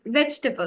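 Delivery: "vegetable" as VEJ-tuh-bul; correct pronunciation. Shown as a demonstration. Teacher puts the corrected word together, reduced syllables. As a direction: keep the end of "vegetable" short and weak. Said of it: "It's supposed to be table".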